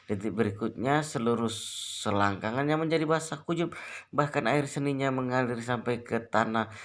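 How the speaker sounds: plain reading voice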